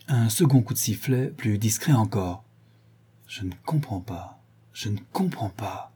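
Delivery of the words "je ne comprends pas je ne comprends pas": softly muttered, understated irritation; annoyed. A narrator's reading. Sholmes puzzled by the whistles, quietly annoyed. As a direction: repeat it under your breath, mildly vexed. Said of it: "dit Sholmes agacé".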